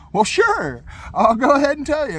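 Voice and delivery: happily volunteer the whole story; cheerful loud reply. Retold or imitated. Answering the troopers' question, a cheery loud reply. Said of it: "I was like".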